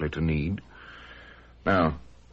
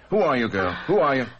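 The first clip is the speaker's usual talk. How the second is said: agitated demand; 7 semitones higher, faster than usual. Acted. Dick confronts the unknown girl.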